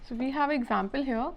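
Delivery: ordinary talk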